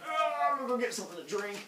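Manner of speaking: high, falling groan into quick speech; stretching yawn. Acted. Zeus wakes at night, stretching groan.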